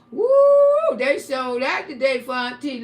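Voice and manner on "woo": very high, loud celebratory whoop; celebrating the likes with a loud woo; cheering whoop. Real, not acted. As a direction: let out a triumphant yell.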